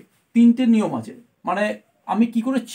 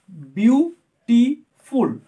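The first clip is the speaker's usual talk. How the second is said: Beautiful slowly broken into its three syllables; teacher's slow syllable count on beautiful, a gap after each part; split into syllables; 5 dB louder, much slower than usual. Shown as a demonstration. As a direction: sound out beautiful piece by piece. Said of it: "more than one syllable আছে".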